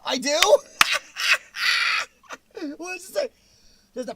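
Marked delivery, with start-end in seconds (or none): 0.4-2.0 loud laugh. Real, not acted. Reacting to chat on stream.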